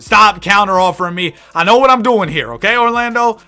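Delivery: loud, high exasperated yell at the game; exasperated outburst at a video game; frustrated yell. Real, not acted. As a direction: snap at the computer like it's a person. Yelling at AI trade partner.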